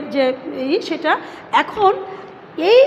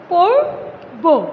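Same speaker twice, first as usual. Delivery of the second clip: slow drawn-out syllable; 2 semitones higher, 3 dB louder, much slower than usual. Shown as a demonstration. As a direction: stretch পর্ব very slowly, syllable by syllable. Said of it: "এখন এখানে খেয়াল করো". (ধীরে টেনে টেনে পর্ব উচ্চারণ)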